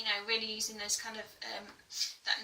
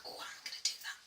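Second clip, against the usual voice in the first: whispered thought; 12 dB quieter, faster than usual. Retold or imitated. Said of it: "really think".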